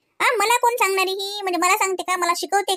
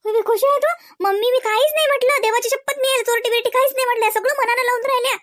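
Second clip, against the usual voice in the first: higher sing-song mock of a sweet denial; a sugary pleading impression, pitched up; mocking innocent voice; 6 semitones higher than usual. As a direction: ham up her fake innocence. Mimicking sister-in-law's innocent plea.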